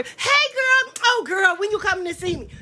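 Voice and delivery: two-faced friend fawning; high syrupy mock welcome; fake sweet greeting. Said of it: "And the next one".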